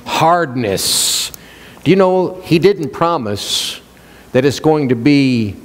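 usual speaking voice

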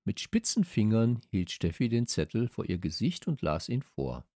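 ordinary delivery, nothing marked

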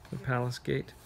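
plain reading voice

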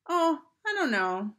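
high, sing-song play at innocence; high-pitched mock ignorance; feigned innocence. Sister feigns ignorance before lunging.

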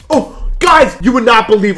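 high and loud, thrilled; excited announcement to viewers; excited outburst. Real, not acted. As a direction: burst out with the news. Just beat hard section.

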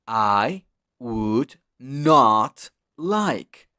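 very slow, every word apart; slow model sentence. Shown as a demonstration. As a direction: spell it out slowly for the class. (muy lento, palabra por palabra)

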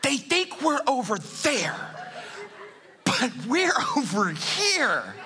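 high, slow, laughing whisper that grows to a cackle; sniggering fish hiding from the nets; giggling fish. Acted. Fish under the boat mocking the fishermen, giggling high. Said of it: "there are fish under there going".

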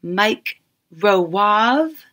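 "Mike Rowave" drawn out very slowly as two names, questioning; "Mike Rowave" overpronounced in a fancy, refined calling voice; fancy overpronunciation. A narrator's reading. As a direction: stretch "Rowave" grandly with a questioning lilt. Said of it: "fancily pronouncing my joke name".

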